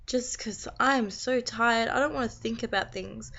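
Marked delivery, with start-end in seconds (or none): none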